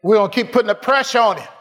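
loud, high, shouted preaching; roaring sermon climax; fiery preaching. Real, not acted. Preaching at full force.

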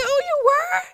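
high, startled mock surprise; surprised. Friend confesses old anger, pastor answers in high surprise. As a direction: genuinely caught off guard. Surprised reply in retold phone call.

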